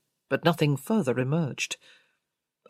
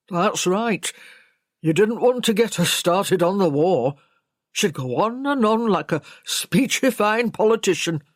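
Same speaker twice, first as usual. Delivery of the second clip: louder servant's voice, emphatic; grumbling servant; 3 semitones higher, 6 dB louder than usual. A narrator's reading. Servant telling the investigators about her war talk, grumbling. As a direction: complain about her endless speeches.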